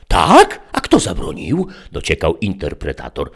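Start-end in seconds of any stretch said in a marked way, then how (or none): 0.1-1.7 high, loud, coaxing tempter; sly interpreter. A narrator's reading. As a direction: sweet-talk the child into it. Interpreter probing. (wysoki, przymilny głos kusiciela)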